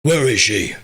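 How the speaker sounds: mocking growly superhero voice, forced and loud; hammed-up interrogation growl. Streamer mocking a movie Batman voice he hates, exaggerated growl.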